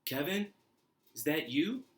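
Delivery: high, rising questioning call; mother calling out. Acted. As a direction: call out as a mother unsure who's there. Mother's voice calls to Kevin.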